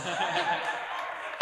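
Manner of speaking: breathy, voiceless laugh; breathy chuckle. Real, not acted. Laughing after the kissing joke.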